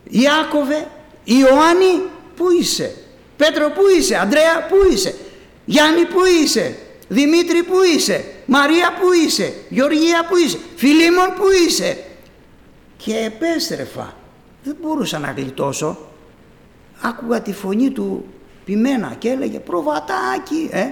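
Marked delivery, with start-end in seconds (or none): 0.0-12.0 high, loud calling of each name. Acted. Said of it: "με φώναζε ο Χριστός". 19.6-20.7 calling. Acted. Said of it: "φώναζε ο Πιμένας".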